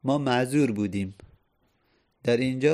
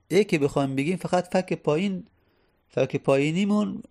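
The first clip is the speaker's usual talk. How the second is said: usual speaking voice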